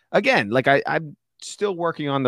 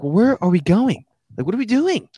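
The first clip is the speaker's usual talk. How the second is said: confused; 10 semitones higher, 6 dB louder than usual. Retold or imitated. Confused self in retold scene.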